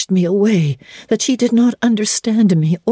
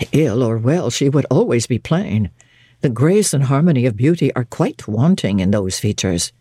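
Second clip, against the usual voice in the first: lower, curt and reserved man's voice; clipped, unexpansive comment, pitched lower; stern young clergyman judging a stranger, curt and low; terse clergyman; 6 semitones lower than usual. A narrator's reading. St. John voiced by narrator.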